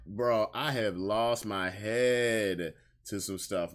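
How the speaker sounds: usual speaking voice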